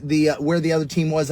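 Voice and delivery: ordinary talk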